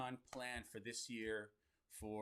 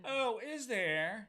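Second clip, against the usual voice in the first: high, slow mock surprise; mock surprised; 10 semitones higher, 7 dB louder, much slower than usual. Shown as a demonstration. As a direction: overdo the surprise. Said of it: "That was weak".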